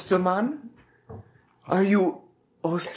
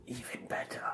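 whisper; 6 semitones lower, 18 dB quieter than usual. Acted. Confiding aside.

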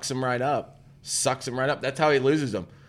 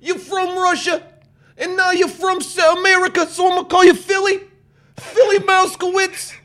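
high, squeaky, loud wise-guy mouse; brash New York cartoon mouse; street smart mouse; 5 dB louder than usual. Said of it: "other little street smart mouse".

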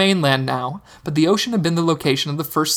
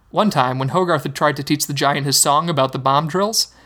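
usual speaking voice

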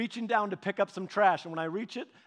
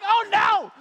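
shrill, loud, much higher panic; loud, much higher mock panic; imitating panicked mom; 13 semitones higher, 11 dB louder, slower than usual. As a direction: squeal like a panicked mom chasing embers. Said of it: "she's like".

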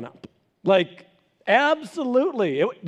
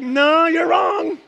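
firm dad; 8 semitones higher, 6 dB louder, much slower than usual. Retold or imitated. Dad answering daughter.

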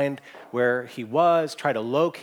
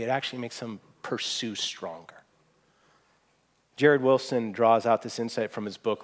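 usual speaking voice

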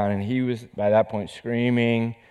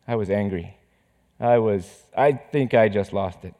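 ordinary talk, nothing marked

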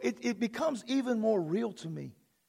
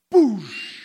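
mouthed boom with a hushing tail; explosion noise; 2 semitones lower, 8 dB louder than usual. Shown as a demonstration. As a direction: imitate a blast going off. Imitates heads being blown.

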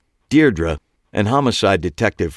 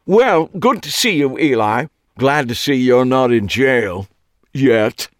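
loud, high, cheery parting jab; bright British Clive; 6 semitones higher, 5 dB louder than usual. A narrator's reading. Clive calling back cheerfully to Eli. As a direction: tease him as you bound off.

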